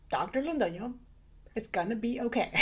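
soft, unhurried reassurance; kind, calm. Retold or imitated. Manager reassuring a panicking doctor, kindly and calmly. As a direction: imitate a manager gently soothing. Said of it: "very kindly and calmly said".

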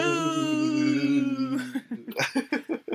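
high loud cackle; cackling laugh. Pretend. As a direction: burst out laughing mid-heckle. Laugh between heckling boos.

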